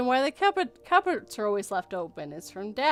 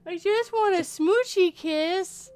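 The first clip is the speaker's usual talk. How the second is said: slow, higher, pleading little voice; mock pleading; 7 semitones higher, slower than usual. Mom begging her husband for a kiss, slow whine. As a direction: draw it out like a whiny plea.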